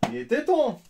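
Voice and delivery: high, loud thrilled shout; excited cheer. Real, not acted. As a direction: shout it in relief. Cheers at the rare card.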